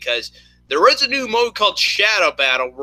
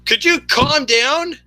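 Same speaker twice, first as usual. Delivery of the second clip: high, slow, drawn-out appeal; exasperated plea; 11 semitones higher, 4 dB louder, slower than usual. Real, not acted. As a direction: plead slowly with someone yelling at you. Pleading with screaming friend.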